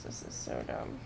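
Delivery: voiceless muttered complaint; whispered gripe. Real, not acted. Grumbling to himself about the once-a-day rule, whispered. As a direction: say it under your breath, fed up.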